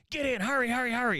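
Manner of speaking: high, urgent mock getaway call; urgent hurrying. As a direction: rush him into the car. Mom rushing son into getaway car.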